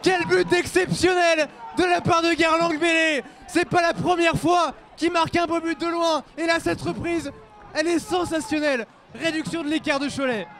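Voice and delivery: shouted goal call; excited sports commentary. Real, not acted.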